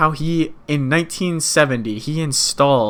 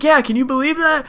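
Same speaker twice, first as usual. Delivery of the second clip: mock amazement; 11 semitones higher than usual. Buster's amazed reply.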